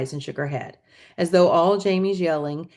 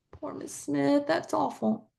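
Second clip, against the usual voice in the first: softer sympathetic aside; 9 semitones higher, 7 dB quieter, slower than usual. Acted. Narrator aside, different voice.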